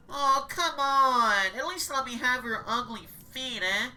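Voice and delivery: high, loud greedy cannibal voice. Acted. Cannibal staffer begging the cook for the witch's rump, loud and greedy. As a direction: leer at the witch like a hungry ghoul.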